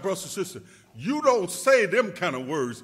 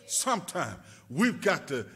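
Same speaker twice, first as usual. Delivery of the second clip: usual speaking voice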